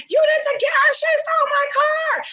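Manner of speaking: high, loud frantic caller; screaming caller. Retold or imitated. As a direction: yell it like a desperate man. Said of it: "still huffing and puffing and screaming".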